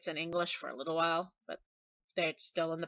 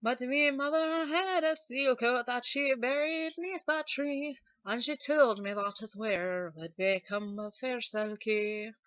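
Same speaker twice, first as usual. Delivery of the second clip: higher Scottish granny voice; Scottish accent; 6 semitones higher, 4 dB louder, slower than usual. Acted. Singer voicing the grandmother's verse, Scottish accent. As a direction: keep the Scottish accent going. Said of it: "I can kinda keep this accent going".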